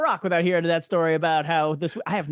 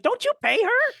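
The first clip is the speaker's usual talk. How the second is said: high, disbelieving retort; incredulous; 11 semitones higher than usual. Retold or imitated. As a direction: challenge him in disbelief. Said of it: "I'm like".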